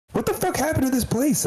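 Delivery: very high, stunned disbelief; incredulous squeak. Retold or imitated. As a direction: throw the line way up in mock shock.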